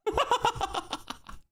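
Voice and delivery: high, rapid falling laugh. Real, not acted. Streamer amused by Saints fans' chant, high laugh. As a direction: burst into high-pitched giggling laughter.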